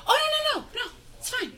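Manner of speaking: imitated six-year-old. Daughter reassuring her mom, imitated high child voice. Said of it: "And she goes".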